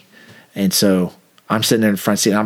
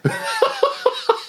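high burst of laughter; laughing; 4 dB quieter than usual. Real, not acted. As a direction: break up laughing. Speaker cracks up.

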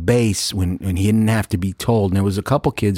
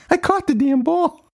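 much higher exultant boast; triumphant. Retold or imitated. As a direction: crow about it.

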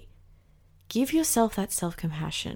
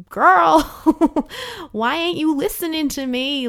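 high, loud sassy call-out with a laugh; sassy scolding; 8 semitones higher, 8 dB louder than usual. Pretend. Body scolding her owner for ignoring it, sassy and loud. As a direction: play your own body telling you off, half laughing.

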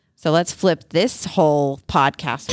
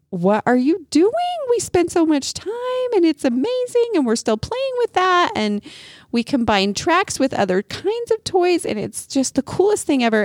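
put-on higher, eager child voice pleading; kids protesting; 12 semitones higher than usual. Children protest to their dad, higher pleading kid voice. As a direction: plead like a kid whose toy is being taken.

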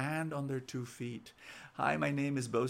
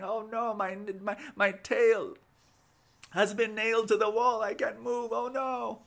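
high-pitched wailing of a trapped lizard, hammed up; mock crying; 8 semitones higher, 5 dB louder than usual. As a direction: wail in pretend distress, pitch climbing. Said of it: "after crying and saying".

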